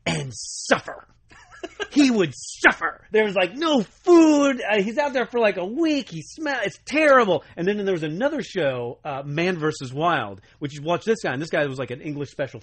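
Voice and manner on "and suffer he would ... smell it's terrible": mock suffering. Mock pity for Survivor Man.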